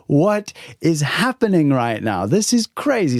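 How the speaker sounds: high, slow, wide-eyed marvel at his luck; amazed disbelief. Retold or imitated. Traveller reliving a stranger's offer of a floor, amazed. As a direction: replay the shock of a stranger's kindness.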